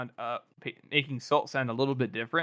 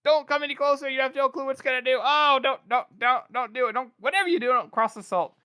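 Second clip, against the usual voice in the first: much higher, frantic pleading; panicked adept; 11 semitones higher, 3 dB louder than usual. Retold or imitated. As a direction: beg them in a high panic not to cross the salt. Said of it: "one of the other Adeptus are like".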